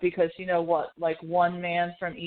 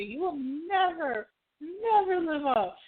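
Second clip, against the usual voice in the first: mock matchmaker; 9 semitones higher than usual. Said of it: "That's exactly me".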